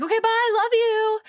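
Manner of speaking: high, sing-out goodbye; breezy hurried goodbye. Retold or imitated. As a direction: toss it out high on the way out. Acting her hurried accidental goodbye.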